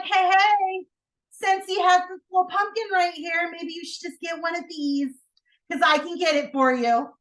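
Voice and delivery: high, loud, cheery pitch; playful sales pitch. Acted. Acts out planned pitch to aunt.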